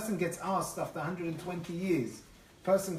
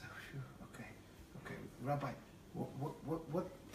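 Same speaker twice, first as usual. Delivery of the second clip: hushed, low sigh of relief; relieved; 3 semitones lower, 11 dB quieter than usual. Retold or imitated. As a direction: let the breath out, then softly grope for words. Relieved farmer calms down.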